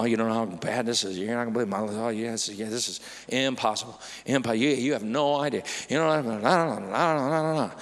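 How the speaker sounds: low, soft, fast anxious muttering; fretful worrier. Acted. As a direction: play a person spiralling in worry. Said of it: "I can magnify my problem".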